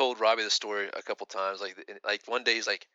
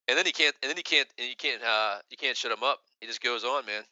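usual speaking voice

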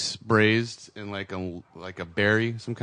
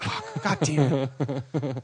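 frustrated curse; 4 semitones higher, much slower than usual. Retold or imitated. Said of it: "And I'm like".

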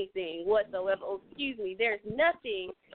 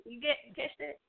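usual speaking voice

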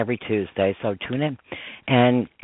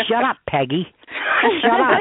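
imitating friends' exasperated yelling, higher pitch; mock-yell it, building on the repeat; playful scolding, rising into a yell; retold yell; 9 semitones higher than usual. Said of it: "They yell at me".

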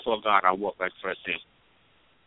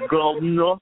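loud, higher mock of her accent on "Governor"; teasing accent imitation; 5 semitones higher, 7 dB louder, much slower than usual. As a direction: mimic her accent to tease her. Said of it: "Leave my accent alone".

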